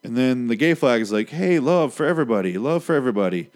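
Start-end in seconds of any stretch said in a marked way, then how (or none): 1.3-3.5 acted friendly holler, pitched up and louder; cheerful welcome. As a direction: greet everyone in a lifted, open voice.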